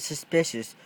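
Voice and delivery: usual speaking voice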